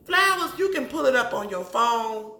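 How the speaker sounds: high, loud scolding burst; Flowers, shouted high and loud in disbelief; exasperated shout. Real, not acted. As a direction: call him out with the one word. Scolding absent husband, loud.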